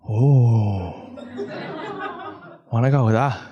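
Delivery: low lightly voiced dog's thoughts, laughing through; a greedy dog eyeing the dunes, half laughing; voicing the dog. Comedian voicing his son's dog-like thoughts, low and amused.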